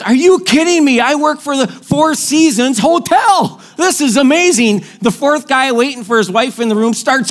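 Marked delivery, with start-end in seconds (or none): 0.0-4.8 excited dad. Retold or imitated.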